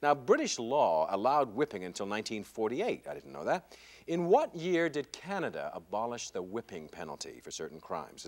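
ordinary talk

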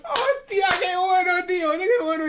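high, loud delighted cry; love the prank out loud; delighted exclaim. Real, not acted. Streamer thrilled by the prank call, high delighted cry.

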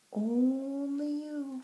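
faint drawn-out wind call. A narrator's reading. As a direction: let it drift like wind. Wind heard by chickadee.

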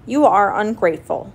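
mildly hurt, scolding protest; reproachful. A narrator's reading. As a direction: chide him as if wounded. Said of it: "said Señor Coyote reproachfully".

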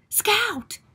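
high, falling cry of "Scout!"; acted urgent summons; alarmed call.

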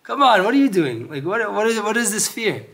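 loudly chiding the fearful, half laughing; high, loud incredulous Hashem. Retold or imitated. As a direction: come on, high and loud, amused disbelief. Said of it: "It's like".

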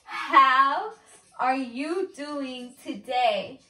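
loud drawn-out HOW, then slow words; slow exaggerated enunciation. Real, not acted. Correcting partner's wrong guess, slow exaggerated enunciation. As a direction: stretch each word for a lip reader. Repeats it for lip reader.

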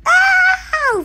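very high loud ow; high shout of pain. Real, not acted. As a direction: yelp in sudden pain. Hit in game.

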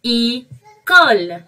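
École stretched slowly, each sound drawn out; slow model word. Shown as a demonstration. Teacher modelling a French word for the kids, drawn out slowly. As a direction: draw École out slowly so kids can copy it. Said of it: "it's mean école".

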